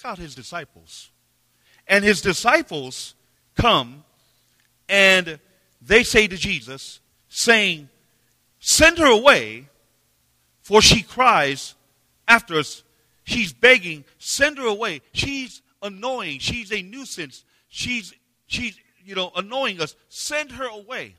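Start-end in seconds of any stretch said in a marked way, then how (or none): 1.9-12.8 loud, high preaching, slow on cries; preacher raising his voice over the congregation; preaching at full force. Real, not acted. 13.2-21.1 high, a touch of exasperation; a hint of disciples complaining to Jesus; annoyed disciples.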